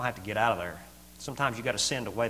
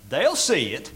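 usual speaking voice

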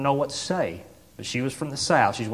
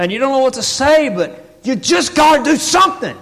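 loud, high, driving preacher delivery; impassioned preaching; 12 semitones higher, 9 dB louder than usual. Real, not acted. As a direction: preach it at full force. Preaching at full force.

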